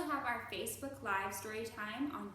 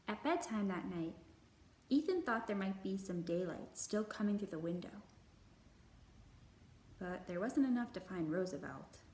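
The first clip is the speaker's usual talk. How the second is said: put-on gentle, measured read-aloud for children; storybook narration; 3 semitones lower than usual. A narrator's reading. Librarian reading a picture book to kids, gentle narration.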